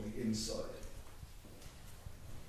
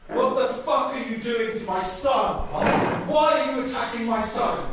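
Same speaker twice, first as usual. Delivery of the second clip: loud, higher furious accusation; furious confrontation; 8 semitones higher, 9 dB louder than usual. Acted. Mother confronting the grandfather over her son, furious shout. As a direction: tear into him for hitting your son.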